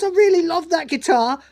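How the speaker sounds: high, hammed-up teenage outburst; teen angst. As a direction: protest like a wounded teenager. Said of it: "Teen angst".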